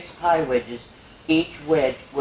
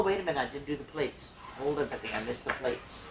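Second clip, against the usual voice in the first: softer, half to herself; muttered aside; 3 semitones lower, 11 dB quieter, faster than usual. Real, not acted. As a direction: mutter the slip under your breath. Catches her own reading mistake.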